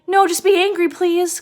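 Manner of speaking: high, loud mock plea; pleading guilty kid. Guilty kid to disappointed parents, high pleading. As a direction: beg like a guilty child. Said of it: "'Cause you're like".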